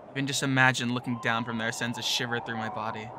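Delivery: ordinary delivery, nothing marked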